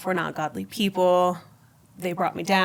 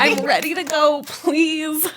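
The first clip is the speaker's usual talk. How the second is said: high, loud begging through laughter; impatient pleading; 9 semitones higher, 4 dB louder, slower than usual. Retold or imitated. Woman playfully begging God to end the wait, impatient pleading.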